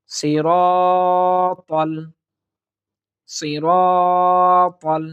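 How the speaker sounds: সিরাতাল drawn out slowly with a heavy ত; slow Quran recitation. Shown as a demonstration. Teacher reciting সিরাতাল slowly for students. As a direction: chant সিরাতাল slowly and fully. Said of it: "ত আছে".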